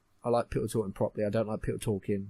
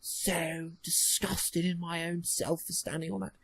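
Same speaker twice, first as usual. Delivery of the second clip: higher, slow, prissy put-on posh voice; posh mock outrage; 4 semitones higher, much slower than usual. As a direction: ham up a fussy aristocrat. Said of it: "Oh, bother".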